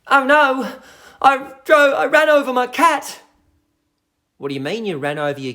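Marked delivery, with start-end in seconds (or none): 0.1-3.2 high, loud panicked cry; mock panic. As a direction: wail it like a cat owner in shock. Acting out viewer's silly comment.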